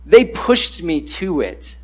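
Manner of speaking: normal talking voice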